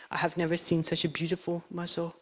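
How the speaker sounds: hushed, softly reverent; choked up. Retold or imitated. Expert admiring a huge mussel shell, a touch choked up. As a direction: imitate someone mildly moved, in a small soft voice. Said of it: "he starts to tear up and choke up a little bit".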